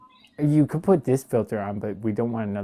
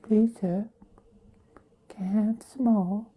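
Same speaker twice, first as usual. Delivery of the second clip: high pleading orphan plea, paws out; Oliver Twist begging; 11 semitones higher than usual. Retold or imitated. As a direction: beg like a hungry orphan. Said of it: "kinda look like Oliver".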